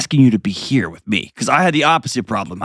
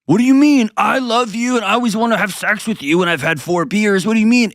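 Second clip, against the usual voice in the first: mocking husband; 11 semitones higher than usual. Coach voices defensive husband.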